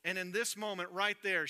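ordinary delivery

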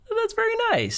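squeaky high-pitched thanks; a high, sing-song imitation of himself; high mock reply. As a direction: jump way up in pitch for the polite reply. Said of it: "I was like".

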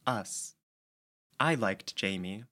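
ordinary delivery

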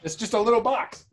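yelling from across the room, away from the mic; distant voice, far off the microphone; calling from far away. Real, not acted. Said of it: "I'm yelling over here".

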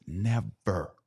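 usual speaking voice

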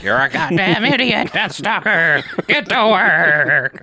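nagging witch yell. Retold or imitated. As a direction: scold him like a nagging mother. Nagging witch berates hero.

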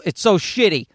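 ordinary talk

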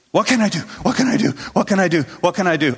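hammed-up flustered chant; acted panic. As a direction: play a collective panic, each repeat easing slightly. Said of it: "And our response is".

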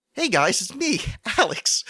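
high, loud bright vlogger intro; chipper host greeting. Pretend. Host mocks his own show voice in a high, loud greeting. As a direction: burst in like an eager vlogger. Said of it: "in the Alex Cast voice".

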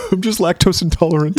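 high, laughing mock excuse; lightly voiced husband hiding tears, laughing; laughing while quoting. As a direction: giggle through a fake excuse. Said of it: "husband caught crying, retold".

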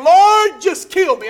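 loud, high anguished cry to God; Jonah's plea. Acted. Jonah crying out to the Lord, loud and pleading. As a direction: wail it out like a despairing prophet. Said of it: "Jonah's saying".

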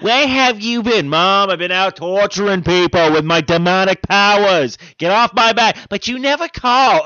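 put-on loud, high Boston accent, bickering family. Demon son snapping at Mom in a Boston accent. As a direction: shout it like a Boston kid fed up with Mom. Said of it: "we all sound like we're from Boston".

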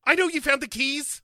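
high squeaky put-on; exasperated high retort; silly high naturalist; Scotty voice.

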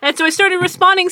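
ordinary talk, nothing marked

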